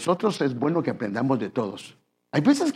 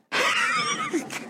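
high laugh; 4 dB quieter than usual. Real, not acted. Preacher laughs at own joke.

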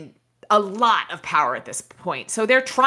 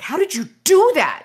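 high, loud startled snarl; 10 semitones higher, 5 dB louder than usual. Acted. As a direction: snarl it in angry surprise. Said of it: "it snarled".

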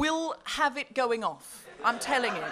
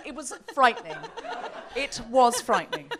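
ordinary delivery

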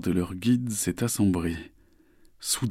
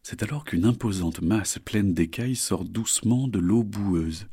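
ordinary delivery

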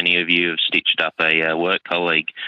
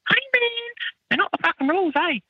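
mock protest. Chef refusing the manager a second time, mock squeaky protest. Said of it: "And I was like".

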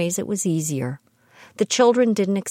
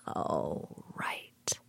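whispered aside; 13 semitones lower, 14 dB quieter than usual. Real, not acted. After laughing at the story.

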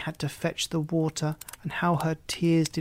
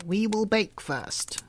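put-on higher, slow sly old woman; a higher, slow cunning witch; scheming witch; 6 semitones higher, slower than usual. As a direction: lure her with slow sweetness. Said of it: "said the old woman".

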